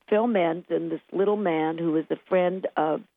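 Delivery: usual speaking voice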